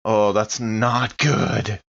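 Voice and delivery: ordinary delivery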